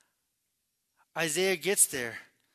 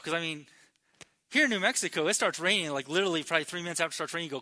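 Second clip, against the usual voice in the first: ordinary delivery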